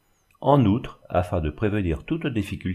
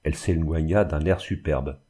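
ordinary delivery, nothing marked

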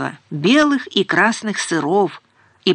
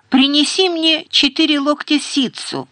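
higher-pitched call, mildly raised; calling after him; 6 semitones higher than usual. A narrator's reading. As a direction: call out after someone leaving, without full shouting. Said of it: "крикнула Ингер ему вдогонку".

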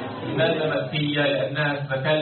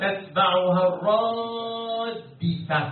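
very slow, higher chant with a long-held vowel; Quran recitation; 5 semitones higher, much slower than usual. Shown as a demonstration. Teacher reciting to prompt the class, sustained chant. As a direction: stretch the verse out on a sustained tone.

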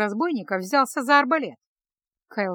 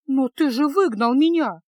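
indignant; 4 semitones higher, 5 dB louder than usual. A narrator's reading. Indignant reminder.

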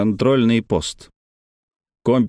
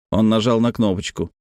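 plain reading voice

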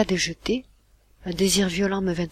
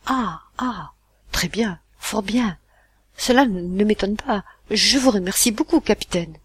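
choked throat; 3 semitones higher, 4 dB louder than usual. Acted. Father thanking the captain after the shock, choked voice. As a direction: force polite thanks through a tight throat. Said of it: "articula la gorge serrée".

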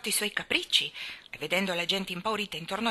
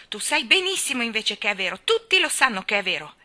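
loud, higher insistent protest; indignant, pressing the point; challenging; 4 semitones higher, 7 dB louder than usual. A narrator's reading. Colin insists his illness is real, defiant. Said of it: "la sfidò".

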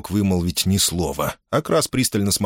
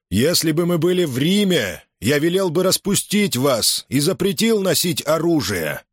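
shouting; 10 semitones higher than usual. A narrator's reading. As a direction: bark it across the silent field. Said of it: "прокричал Помпей".

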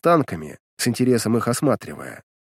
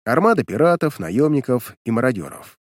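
ordinary delivery, nothing marked

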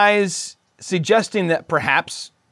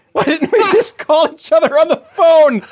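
laughing; 11 semitones higher, 3 dB louder than usual. Real, not acted. Laughing at their own tech troubles.

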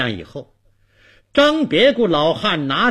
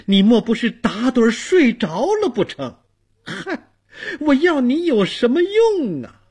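lament, then berate the useless door god; bitter complaint; 7 semitones higher, 3 dB louder than usual. Acted. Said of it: "心中直叫的苦". (叫苦埋怨，越说越高声)

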